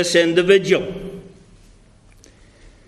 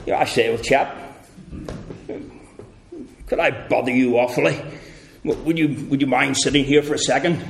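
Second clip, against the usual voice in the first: lower, fast posh English gent asking politely; posh southern English; 5 semitones lower, 5 dB quieter, faster than usual. Retold or imitated. Preacher mocks polite English gentleman asking someone to sit. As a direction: do an overly polite old-chap English voice. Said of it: "folks from the south of England would say".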